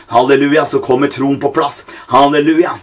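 usual speaking voice

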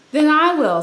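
the hen taking charge, loud and high; determined hen. Acted. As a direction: declare it firmly as the hen. Hen decides alone.

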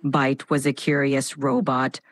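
low, dramatic robot voice; dramatic robotic voice. Shown as a demonstration. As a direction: announce it like a booting machine. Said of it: "Initiating dramatic robotic voice".

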